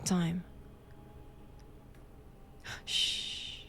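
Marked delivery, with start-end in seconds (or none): 2.8-3.6 sharp unvoiced shush; whispered hush. Hushing the prisoner about the Count.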